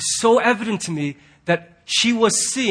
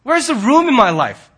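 louder, higher frantic complaint; overwhelmed man; 6 semitones higher, 4 dB louder than usual. Retold or imitated. Pastor voicing a burdened man who could shut down, frantic. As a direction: voice a stressed-out guy near his limit. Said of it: "could so easily go".

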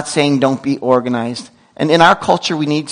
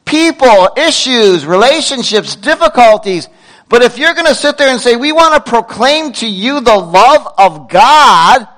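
higher, louder impassioned apostle; Paul lecturing; 8 semitones higher, 4 dB louder than usual. Retold or imitated. Preacher voicing Paul scolding Corinth, impassioned. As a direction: plead with the church like Paul. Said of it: "Paul was telling them".

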